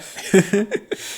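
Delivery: short laugh at the story; narrator's brief amused laughter. Real, not acted. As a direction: laugh at the absurd confession. Narrator laughs after the confession.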